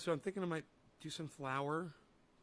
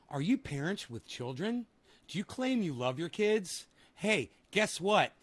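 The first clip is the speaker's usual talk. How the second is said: mock preachy rant; 5 semitones higher, 10 dB louder, slower than usual. Mock lecture to parents.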